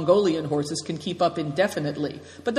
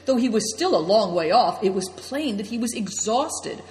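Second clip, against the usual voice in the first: usual speaking voice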